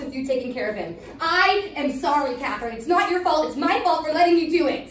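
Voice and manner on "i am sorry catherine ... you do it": loud, emphatic, raised voice; heated and insistent; angry apology. Acted. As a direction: apologise forcefully, like an accusation. Heated non-apology in quarrel.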